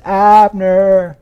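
loud, high, long-held holler; echoing call. Acted. David rousing the commander across the wilderness, a loud echoing call. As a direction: holler so it rings over the wilderness. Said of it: "echoing over the wilderness".

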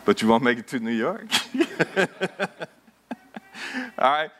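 usual speaking voice

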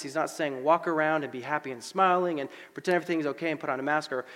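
ordinary talk

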